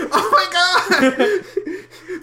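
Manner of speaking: high screeching laughter; shrieking laugh. Real, not acted. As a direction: crack up in a loud shriek.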